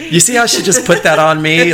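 laughing protest. Real, not acted. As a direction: laugh through it, amused.

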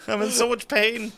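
hammy, high dying plea; milk a fake dying moment; overacted death scene. Hammed-up dying after hair pull.

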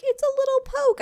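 put-on high small child voice; little girl voice. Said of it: "she's like".

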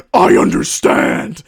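hoarse, drawn-out roar; huge rasping movie-hero shout. Retold or imitated. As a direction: bellow it full force in his face. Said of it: "He just shouted in his face".

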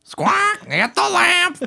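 very high, squawking parrot voice; Gilbert Gottfried voice. Pretend. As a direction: screech it like a cartoon parrot. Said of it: "You sound like Gilbert Gottfried".

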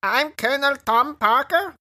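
dim recruit voice. Acted. Parroting recruit answering sergeant.

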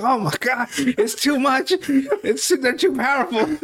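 a touch of hammed-up swooning; mock overwhelmed. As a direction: play it like the tension is making you faint.

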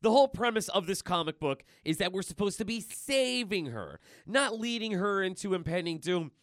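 mock him like an exasperated smartass; Deadpool sarcastic. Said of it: "Deadpool's just like".

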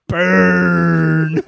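loud crowing shout; shouted burn. Real, not acted. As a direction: call it out like a winning jab. Crowing over a friend's joke.

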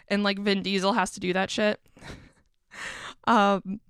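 ordinary talk, nothing marked

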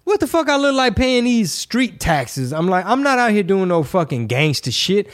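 high, mocking disbelief; incredulous retort. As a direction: scoff like the idea is absurd. Acted outraged reply about taxes.

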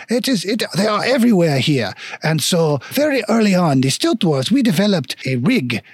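lilting mock-French lecturer; hammy French stilt dwarf explaining; bad French accent. Stilt dwarf tells adventurers about stilt rigs, bad French accent. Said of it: "very shitty French accent".

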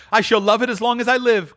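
high, delighted Scrooge; joyful cry. Acted. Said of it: "cried Scrooge".

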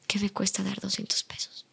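soft whisper to herself; whispered aside. Retold or imitated. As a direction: breathe it out as a private thought. Retelling her words to the woman.

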